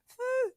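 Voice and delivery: high, soft whistled phew like a pet turtle; turtle whistle. Retold or imitated. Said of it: "That's how he whistles".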